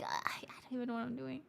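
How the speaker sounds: fast flustered whisper; hushed, rushed aside. Real, not acted.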